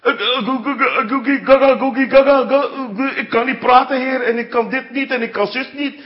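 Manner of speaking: high, loud, hammed-up stutter. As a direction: stammer wildly as a panicking Moses. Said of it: "begon hij te stotteren". (overdreven stotteren)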